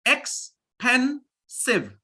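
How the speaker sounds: expensive spoken very slowly, ex-pen-sive split apart; syllable-by-syllable demo. Shown as a demonstration. Teacher breaking expensive into syllables for the class, very slow. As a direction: pull expensive apart into three slow beats. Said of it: "Now look".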